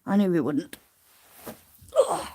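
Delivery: ordinary talk, nothing marked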